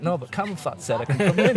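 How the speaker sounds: retold protest. Clubber arguing with security that the DJs invited him, protesting.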